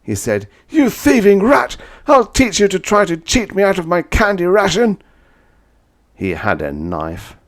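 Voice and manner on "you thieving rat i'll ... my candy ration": loud, much higher voice of a crazed man; raging enemy. Acted. Crazed enemy rages at the narrator, loud and high. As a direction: rave in fury.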